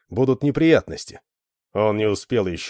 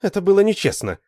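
high-pitched, firm accusation; insistent reproach; 11 semitones higher, 4 dB louder than usual. A narrator's reading. Lita accuses Alan once more, insistent. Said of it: "заявила снова она".

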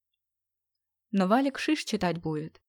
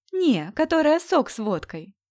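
smirking; 7 semitones higher, 5 dB louder than usual. Acted. Smirking joke in reply.

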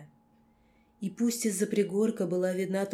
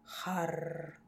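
growled command; 8 dB quieter than usual. A narrator's reading. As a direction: growl it like a wolf. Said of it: "произнёс одно единственное слово".

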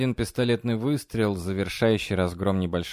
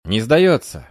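called reply; 3 semitones higher, 7 dB louder, slower than usual. Acted. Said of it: "последовал ответ".

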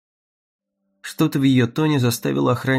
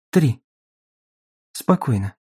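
dispassionate. Acted. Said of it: "бесстрастно сказала она".

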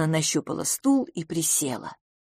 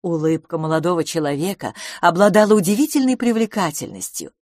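ordinary delivery

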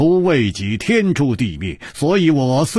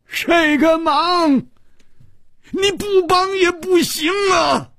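much higher, slow, forceful yell; furious shout; slower than usual. A narrator's reading. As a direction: bellow the threat word by word. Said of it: "他怒喊道".

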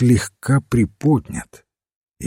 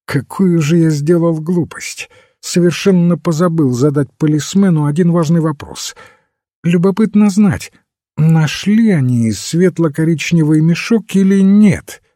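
high, raised-voice outburst; sudden exclamation; 8 semitones higher, 5 dB louder than usual. A narrator's reading. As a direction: exclaim as a thought suddenly strikes. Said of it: "он вдруг воскликнул". (взволнованно восклицая, высоким голосом)

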